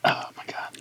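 whispered horror; whispering. Real, not acted. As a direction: whisper it in disbelief. Whispered disgust.

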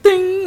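church bell ding. Retold or imitated. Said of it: "se escuchó caleta la campana".

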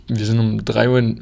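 normal talking voice

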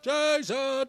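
high loud call of a name; calling out Jason. Retold or imitated. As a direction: shout the name around the house. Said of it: "we were going".

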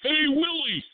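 high squeaky alien voice; Alf voice. Retold or imitated. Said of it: "That's my elf impression".

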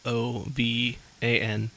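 slow, each letter spelled apart; spelling letter by letter. Pretend. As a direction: spell it out for the judges. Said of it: "spelling bee".

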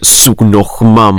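put-on slow Welsh accent, menacing villain; Welsh Darth Vader voice. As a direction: drag out the words in a thick Welsh lilt. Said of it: "In his own Darth Vader voice".

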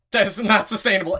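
put-on seven-year-old self. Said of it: "And I'm like".

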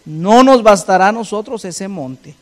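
loud, ringing scripture read; preaching at full force. Acted. Preacher reading scripture loudly to the congregation. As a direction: proclaim the verse to the congregation.

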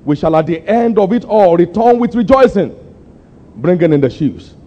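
high, pleading declaration; impassioned vow. Retold or imitated. Preacher quoting his old letter to the church, high and impassioned. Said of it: "I said".

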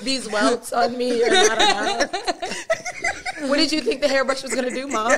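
loud, high, rushed through laughter; laughing mock protest. Daughter protests to mom after whooping, laughing mock protest. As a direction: protest incredulously while cracking up. Laughing retort to mother, retold.

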